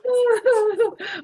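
very high hissing laugh; snake laugh. Shown as a demonstration. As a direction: laugh through your teeth like a snake. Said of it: "you could just do the snake".

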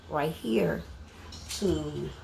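ordinary talk, nothing marked

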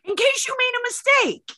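high, loud, breathless-with-joy plea; giddy urgency. Retold or imitated. Puppeteer begging to sign before they change their minds, giddy. Eager to secure the surprise contract.

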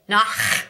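throaty German ch in Nach; guttural nach. Shown as a demonstration. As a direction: rasp the ch of Nach at the back of the throat. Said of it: "we'll use the words nich and nach".

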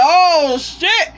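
sharp high surprised yelp; sudden spooked outburst rising high; startled oh shit. Retold or imitated. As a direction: jump at the sight, high and loud. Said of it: "They're like".

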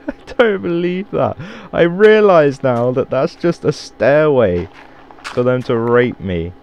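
usual speaking voice

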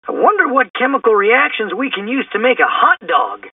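high, wondering cartoon slacker; high-pitched goofy character voice; hungry Shaggy. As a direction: wonder aloud as food-loving Shaggy. Plays Shaggy in the story.

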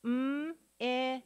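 M-I-S as held mmm, short ih, sss; each sound of M-I-S alone, not letter names. Shown as a demonstration. Teacher sounding out a syllable, M-I-S sound by sound. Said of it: "Let's sound out each syllable".

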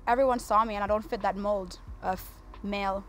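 ordinary talk

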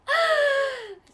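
loud, high cry of dismay; long wail sliding downward; high falling wail. Real, not acted. Gamer seeing the team wiped out, loud high wail. Reacts to party wipeout.

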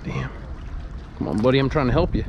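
ordinary talk, nothing marked

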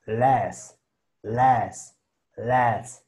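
"las" with a clear hissing S; las drill. Shown as a demonstration. Teacher drills the syllable "las" for students. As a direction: repeat "las", leaning on the S. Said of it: "lo que sería la S".

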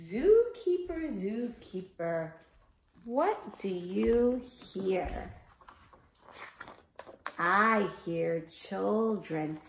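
slow, loud storybook chant; reading to children. Acted. Teacher reads the zookeeper rhyme to the class, slow loud chant. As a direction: read it out big and slow for little kids.

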